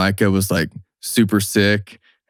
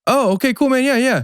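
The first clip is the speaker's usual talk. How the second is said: much higher, airy mock-polite agreement; mock friends' reply. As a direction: play the unconvinced buddies. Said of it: "they'd be like".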